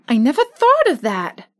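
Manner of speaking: put-on bright, excited girl; delighted sudden idea; joyful. Dorothy answers Scarecrow's idea, joyfully. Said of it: "said Dorothy joyfully".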